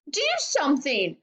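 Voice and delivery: high, urgent demand; high-pitched pleading order; screamed. A narrator's reading. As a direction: screech at your husband to act. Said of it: "Missus Globe screamed at Mister Globe".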